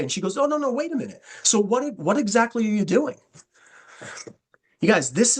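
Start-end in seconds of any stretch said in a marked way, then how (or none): 0.4-3.2 much higher, mock surprised turnaround; imitating a prospect caught off guard and curious; sudden interest. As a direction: jump up in pitch as she changes her mind.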